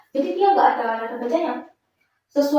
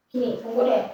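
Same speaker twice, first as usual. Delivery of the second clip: usual speaking voice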